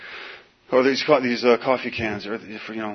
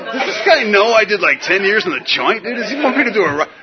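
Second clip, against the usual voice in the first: high, loud laughing disbelief; incredulous inner voice; 10 semitones higher, 7 dB louder than usual. Retold or imitated. Ex-con wondering at cop's ride-along offer, laughing high. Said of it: "I'm just like".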